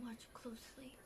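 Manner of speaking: whispered, questioning repeat; hushed echo. Real, not acted. Viewer querying the narrator's instruction, hushed whisper. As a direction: drop to a whisper, querying the phrase. Echoing the narrator while watching closely.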